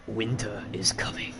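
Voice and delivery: slow hushed whisper, going for grim northern gravitas; Jon Snow impression. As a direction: breathe it out slowly like a brooding Jon Snow. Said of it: "that was terrible".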